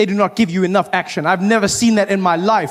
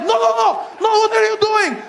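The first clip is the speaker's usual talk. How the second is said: guards shouting. Retold or imitated. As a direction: stop her in panic. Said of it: "they're like".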